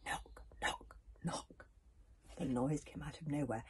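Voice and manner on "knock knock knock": softly whispered knock knock knock; spooky knocking. A narrator's reading. Narrator whispers the mysterious cauldron knocks. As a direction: whisper the knocks like a far-off door.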